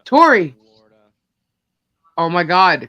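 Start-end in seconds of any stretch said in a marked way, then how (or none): 0.1-0.6 calling out. Real, not acted. Calling for a friend.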